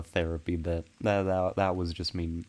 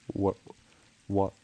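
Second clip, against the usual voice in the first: normal talking voice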